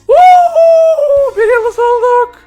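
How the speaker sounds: very high, loud, slow cheering; ecstatic cheer. Real, not acted. Player celebrating becoming a star, ecstatic cheer. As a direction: cheer wildly at the win.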